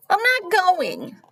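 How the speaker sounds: put-on high, loud, drawn-out child's protest; defiant refusal. Sarah refusing to leave her bed, high defiant protest.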